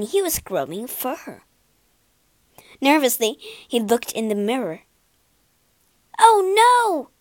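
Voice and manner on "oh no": very high, loud cry of dismay; horrified cry. Acted. Pinocchio sees donkey ears in the mirror, high loud cry. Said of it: "he cried".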